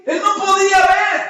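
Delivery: high, loud, impassioned narration; shouted preaching. Real, not acted. Preacher explains the blind man could only shout, shouting. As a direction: preach it at full force.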